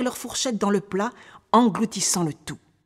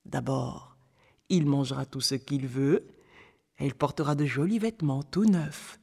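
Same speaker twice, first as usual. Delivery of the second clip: put-on a touch lower, storyteller's old man; lightly voiced old man; 4 semitones lower than usual. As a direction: lightly play old Toussaint tempting the boy. (vieil homme légèrement joué, voix plus basse)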